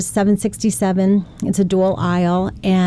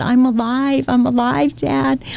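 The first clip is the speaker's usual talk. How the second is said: emotional reassurance; 5 semitones higher, slower than usual. Retold or imitated. Daughter reassures crying father.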